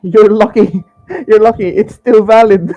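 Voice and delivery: loud, high, gleeful teasing; excited laughing. Real, not acted. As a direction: crow it out through laughter. Laughing gloat at player.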